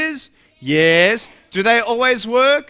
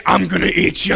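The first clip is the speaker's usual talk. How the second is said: growly mock monster threat; playful monster; 4 dB louder than usual. Speaker retelling chasing a little girl, playful monster voice. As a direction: chase a kid as a pretend monster. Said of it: "I'm running after her saying".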